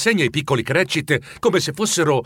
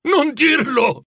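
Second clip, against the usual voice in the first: high, urgent outcry; alarmed interruption; 12 semitones higher, 4 dB louder, slower than usual. A narrator's reading. Scrooge stopping the ghost's bad news, high and alarmed. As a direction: cut in, pleading in alarm. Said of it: "lo interruppe Scrooge".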